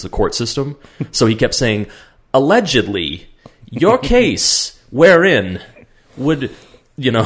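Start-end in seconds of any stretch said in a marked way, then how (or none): 2.3-6.5 higher, slow, puffed-up lawyer talk, laughing through it; mock pompous legalese. Friend imitating Chucky faking big courtroom words, mock-pompous and high. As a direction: ham up fake courtroom vocabulary, slowly and high. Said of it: "trying to just use big words".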